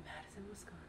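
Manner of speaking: barely audible whisper; whispered to herself. Real, not acted. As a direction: mouth it to yourself while thinking. Muttering options to herself.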